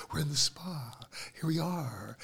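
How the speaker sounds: hushed, softly imitating spa staff; mock spa whisper. Host mimicking spa workers who never talk loudly, mock whisper. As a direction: speak as if nobody may be disturbed. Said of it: "they whisper. They don't really talk loudly".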